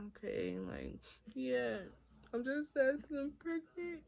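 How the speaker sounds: soft, high-pitched, pitiful reply; small sad voice. Retold or imitated. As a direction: play a meek, sad younger self. Retells her young, sad self agreeing.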